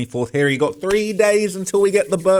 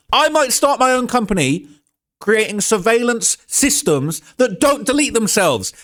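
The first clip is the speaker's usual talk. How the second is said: higher, heated rant; pissed off; 6 semitones higher than usual. Real, not acted. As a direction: keep ranting, exasperated and raised. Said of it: "can you see how pissed off I am?".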